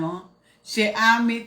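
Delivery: normal talking voice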